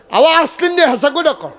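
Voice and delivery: high, loud put-on voice of Zakir; mock surprised reply. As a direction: squeal it back like a startled friend. Zakir voiced in retold story.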